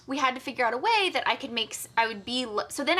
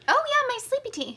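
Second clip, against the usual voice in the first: put-on baby voice; 4 dB louder than usual. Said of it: "talk in baby voice".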